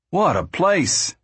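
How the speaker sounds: high, drawn-out exclamation; excited. A narrator's reading. Al back at the family tent, excited. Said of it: "Al came excitedly to the tent".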